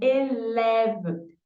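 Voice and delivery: élève drawn out very slowly, each sound clear; teacher modelling élève very slowly; slow model word. Shown as a demonstration. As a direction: stretch élève out for students to copy.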